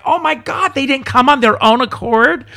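loud, high cry of dismay; high-pitched, incredulous "oh my God" outburst; mock horrified outcry. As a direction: exclaim it aghast, playing up the horror. Retelling a nightmare, aghast.

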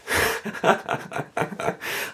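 soft, rapid giggle of embarrassment; breathy chuckle. Real, not acted. Podcaster laughing at being out of breath, soft chuckle. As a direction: laugh it off under your breath.

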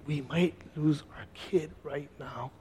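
very soft, slow, retold anguish; a family's heavy thought, imitated softly; grave dread. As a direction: say it slowly, hushed with dread. Family thinking they might lose child.

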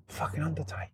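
whispered grumble to yourself; hushed, incredulous inner thought; muttered disbelief. Retold or imitated. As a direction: say it under your breath. Retold private thought, brooding.

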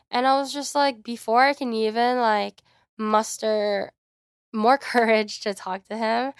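ordinary delivery

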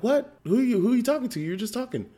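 very high-pitched, baffled retelling; incredulous questioning. Retold or imitated. Dad questioning his son again, incredulous and high-pitched. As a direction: press the question in mock alarm.

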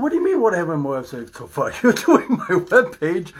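incredulous, laughing through the words; laughing protest. Retold or imitated. Retorting to his son, laughing in disbelief.

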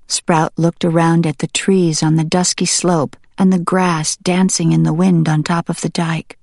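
usual speaking voice

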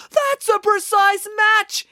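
high, loud, slow yell. Acted. Animesh yelling that the shoe matches the footprint. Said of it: "yelled Animesh".

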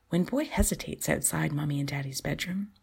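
plain reading voice

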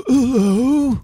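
high, overdone English "Hello."; mock a stiff English "Hello."; bad English accent. Mocking Keanu's Dracula English accent, pitched high. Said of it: "he did the English accent in Dracula".

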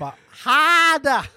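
slow, high, loud mock Boston harder; hammed-up Boston moan; Boston accent.

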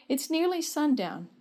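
calling out. A narrator's reading. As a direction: call the family in, without shouting. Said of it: "Dad called soon after".

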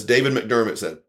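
ordinary delivery

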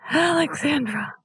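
name said on an in-breath; talking while inhaling. Real, not acted. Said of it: "you can try and say your name, um, while inhaling".

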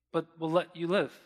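normal talking voice

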